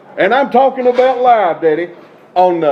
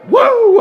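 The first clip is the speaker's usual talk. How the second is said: sudden high loud "woo"; excited whoop; 13 semitones higher, 4 dB louder than usual. Real, not acted. Wrestler losing control on camera, high whoop. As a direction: whoop like you can't hold it in. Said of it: "I'm getting out of control".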